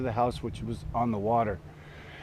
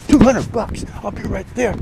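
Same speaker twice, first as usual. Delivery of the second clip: high, loud hammed-up eager reply; change your mind at once for the money; imitating a shopkeeper won over by cash; sudden eagerness; 9 semitones higher, 10 dB louder than usual.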